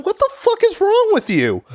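high-pitched mock outrage, not screamed; imitated angry coach. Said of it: "it's not like he's screaming".

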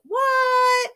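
high, loud, crying shout of alarm; panicked cry. Retold or imitated. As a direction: shriek it in real panic. Discovers water, no rain outside.

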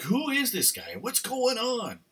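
high, slow cries of alarm; voicing scared disciples in the storm; panicked disciples. Disciples panicking at the sleeping Jesus, high and slow. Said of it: "They're like".

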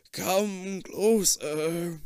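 put-on very slow, friendly old man; very old faint voice. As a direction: coax them in, slow and old. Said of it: "the old man says in a friendly voice".

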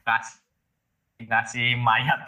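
glitchy robotic call; choppy, cutting out on a bad line; breaking up call. Real, not acted. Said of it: "kamu putus-putus loh".

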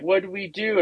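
excited check on the score; pumped up. Retold or imitated. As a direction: ask it keyed up, retelling the moment. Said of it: "all pumped up".

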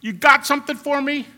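loud, high taunting challenge to death. Retold or imitated. Preacher voicing Paul taunting death, loud and high. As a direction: get right in death's face and taunt it. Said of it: "he's talking like this".

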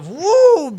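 high, loud "fum" imitating the clipper cut; razor swoosh. Teller imitates the clipper cutting a stripe, mock swoosh. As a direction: snap out the clipper's swipe. Sound effect of the razor slip.

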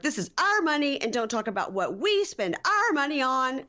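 high, heated imitation of a furious wife; retold outburst, pitched high; mock angry rant. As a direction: snap it out like an indignant wife. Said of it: "Lacey goes nuts".

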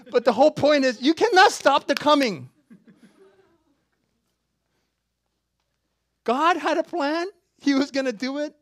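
loud, high climactic preaching; preaching at full force. Acted. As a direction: lift the congregation to the peak.

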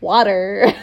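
mock American "water", R held; American accent. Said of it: "I'm American".

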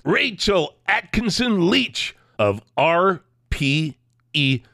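big, swooping, high-energy announcer delivery; showman podcast intro. Acted. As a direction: hype the sponsors and guest, drawing out key words. Hypes up show opening.

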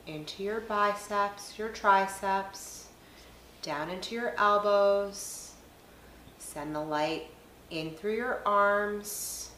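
soft, unhurried meditation voice with long pauses; guided meditation. Acted. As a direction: guide them gently through each breath, leaving space between phrases. Leads a guided breathing meditation.

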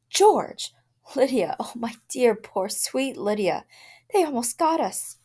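high, loud, frantic cries; wife clinging to husband after the lions; panicked. A narrator's reading. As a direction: gasp it out in terror.

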